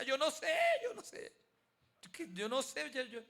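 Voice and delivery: high, loud, stammering helplessly; guard answering the screaming woman, panicked and lost; bewildered panic. Retold or imitated. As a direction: babble it like you have no idea what's happening. His bewildered answer to her.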